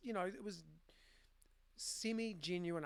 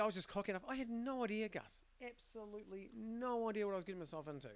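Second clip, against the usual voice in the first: emphatic and higher-pitched admission; enthusiastic; 5 semitones higher than usual. Real, not acted. Bike shop founder telling the interviewer he was clueless, enthusiastic. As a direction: own up to it with real enthusiasm. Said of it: "I sound quite enthusiastic about that".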